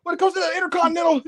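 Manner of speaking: high, fast mocking voice of Sammy; a hammed-up Sammy impression; mock Sammy rant. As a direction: ham up a whiny wrestler promo. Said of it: "He's like".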